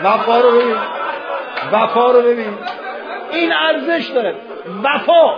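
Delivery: high, shouted exhortation; impassioned shout. Real, not acted. Preacher urges weeping congregation to see loyalty, impassioned shout. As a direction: shout each phrase out at full force.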